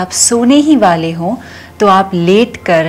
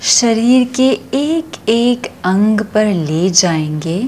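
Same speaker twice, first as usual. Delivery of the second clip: slow, soothing yoga nidra voice with long pauses; guided meditation; 5 dB quieter, slower than usual. Acted. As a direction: stretch the key words and let each phrase settle. Leads yoga nidra relaxation.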